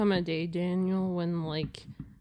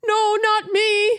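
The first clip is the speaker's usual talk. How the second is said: scared man voice; 11 semitones higher, 6 dB louder than usual. Retold or imitated. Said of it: "this guy in the middle's like".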